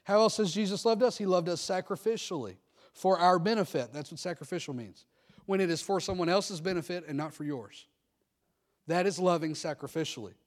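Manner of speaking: ordinary delivery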